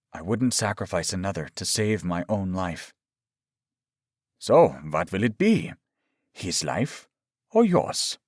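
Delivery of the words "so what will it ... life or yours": lightly voiced sinister doctor, deliberate pauses; taunting villain. A narrator's reading. Doctor forcing a captive to choose, taunting. Doctor demands a cruel choice.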